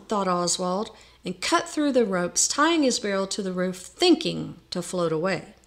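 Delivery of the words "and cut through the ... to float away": usual speaking voice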